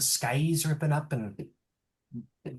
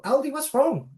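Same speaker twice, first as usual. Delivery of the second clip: worried companion; 11 semitones higher, 3 dB louder than usual. Acted. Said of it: "and they go".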